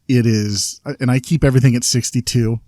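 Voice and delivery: usual speaking voice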